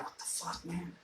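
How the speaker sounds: whispered disbelief; hushed what-the-hell; stunned whisper. Retold or imitated. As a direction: breathe it out in shock, no voice. Shocked at lizard fed to cat.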